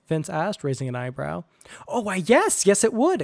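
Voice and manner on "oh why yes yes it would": higher, louder eager exclamation; excited exclamation. A narrator's reading. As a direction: blurt it out, delighted. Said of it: "exclaimed Sam".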